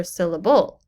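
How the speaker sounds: "syllable" with the final syllable wrongly stressed, sil-la-BULL; stress on last syllable. Shown as a demonstration. Teacher shows strange wrong stress, last-syllable stress. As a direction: lean hard on the end of "syllable". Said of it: "if you have the stress on the wrong syllable".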